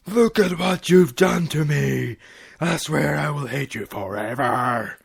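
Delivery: hammed-up rage then fading; wife raging at husband; furious. A narrator's reading. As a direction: rant at him through the pain.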